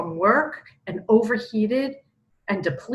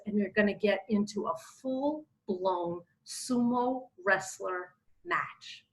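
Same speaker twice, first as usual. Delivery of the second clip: usual speaking voice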